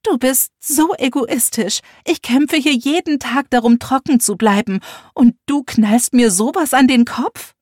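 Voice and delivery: mother defending herself, hurt and loud; indignant mother. Acted. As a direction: hurl the reproach back.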